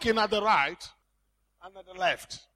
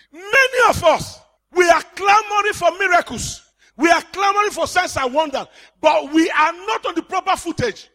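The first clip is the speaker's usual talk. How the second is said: loud, higher fervent exhortation; preaching shout; 5 semitones higher, 5 dB louder than usual. Acted. Preacher rebuking miracle-seekers, loud preaching shout. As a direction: cry it out to the whole church.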